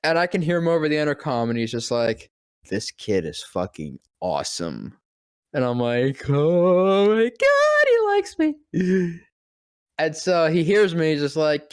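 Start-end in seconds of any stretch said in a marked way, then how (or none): 2.7-4.9 lower, softer praise; deep actor voice. Retold or imitated. Voice actor praising the kid over the intercom, low. 6.3-8.6 high, loud, slow squeal; thrilled squeal. Retold or imitated. Kid overhearing the actor's praise, squealing high.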